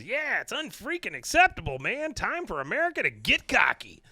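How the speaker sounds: high-pitched hyped-up rocker impression; rally the crowd in a high, indignant rocker voice; [name]. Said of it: "up comes [name]".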